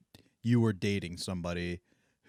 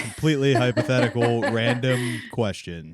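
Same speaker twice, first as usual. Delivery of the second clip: laughing through the words; amused denial; 3 semitones higher, 6 dB louder than usual. Real, not acted. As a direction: protest through laughter, stretching the end. Laughing denial when teased.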